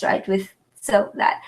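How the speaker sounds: ordinary talk, nothing marked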